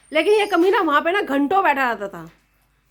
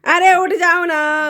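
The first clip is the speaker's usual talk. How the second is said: louder and drawn out, slow; slow loud coaxing; 3 semitones higher, 7 dB louder, much slower than usual. Real, not acted. Daughter-in-law pleading with her sleeping mother-in-law, loud and slow. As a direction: drag out the plea to get up.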